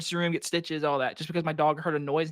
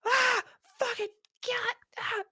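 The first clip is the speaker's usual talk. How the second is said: high, drawn-out pretend freak-out; mock snake panic. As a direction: play at recoiling from a snake, high-pitched. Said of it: "I'd be like".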